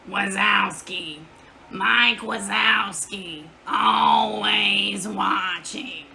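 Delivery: slow, drawn-out monster voice; slow creepy Roz-style drawl; Mike Wazowski impression. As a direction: draw out each word like a one-eyed monster. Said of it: "That's my impression".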